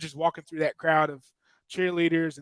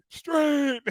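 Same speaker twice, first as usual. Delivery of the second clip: mock strain; 11 semitones higher, 3 dB louder than usual. Straining on the sideline, acted.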